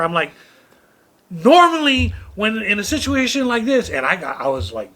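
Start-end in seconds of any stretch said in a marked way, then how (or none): 1.3-3.9 high, loud, indignant lecture; livid retelling. Retold or imitated. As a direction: lay down the rules, pitched way up.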